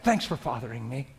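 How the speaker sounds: prayer sinking to a soft hush; private prayer. Shown as a demonstration. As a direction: talk to God like a close friend. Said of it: "I'll be driving and just talk like that".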